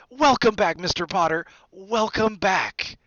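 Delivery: high, loud, emotional welcome; tearfully overjoyed. A narrator's reading. Bartender seizing Harry's hand, tearfully overjoyed. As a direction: cry out the welcome, lingering at the end. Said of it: "tears in his eyes".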